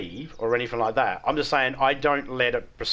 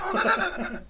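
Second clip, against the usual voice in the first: laugh. Real, not acted. Laughing at his own retelling.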